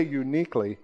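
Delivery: "uniquely" set apart with emphasis; stress on uniquely. Real, not acted. Preacher on being singled out by the enemy, stressing "uniquely". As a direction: lean on the word "uniquely". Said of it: "I put emphasis on that word".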